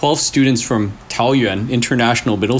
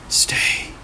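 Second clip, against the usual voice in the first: breathy unvoiced whisper, barely there; ghostly whisper; 4 dB quieter than usual. A narrator's reading. The ghost in the mirror whispers to the teens. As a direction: breathe the word like a ghost against glass. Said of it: "A whisper, soft, like breath against glass".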